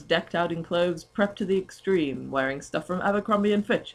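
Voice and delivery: ordinary talk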